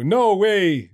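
mock apostles' refusal. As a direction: brush it off like the apostles, half-hearted. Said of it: "that's my impression of them".

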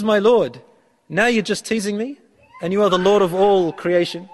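incredulous plea. Retold or imitated. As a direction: protest that the Lord must be teasing. Man's incredulous reply, translated.

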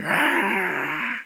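high mock-demonic cry; imitated demonic manifesting. Preacher mimicking a manifesting businessman for the congregation, high demonic cry. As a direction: let out a high "aah" like someone possessed. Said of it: "he just started manifesting, just started".

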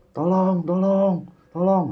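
high, loud drawn-out cry for help; imitated scream for help. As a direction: call out "help" as if heard from another room. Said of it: "teriak, Bang". (menirukan teriakan minta tolong)

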